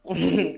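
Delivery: short burst of real laughter; laughing. Real, not acted. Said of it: "I gotta laugh".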